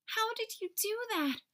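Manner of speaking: high-pitched wonder; amazed little letters. A narrator's reading. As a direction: ask in storybook astonishment, voice way up.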